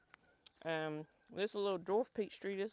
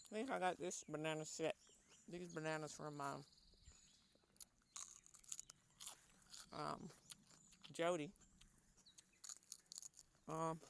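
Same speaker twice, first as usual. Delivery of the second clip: chewing; 7 dB quieter than usual. Real, not acted. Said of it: "sorry, I'm crunching the ear".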